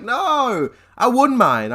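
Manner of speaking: high, drawn-out "No"; mock protest. Player correcting his upset friend on the phone, high-pitched protest. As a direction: swoop right up in protest.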